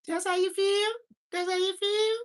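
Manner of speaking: put-on high little kid voice, daring and loud; squeaky kid challenge. Kid confronting again.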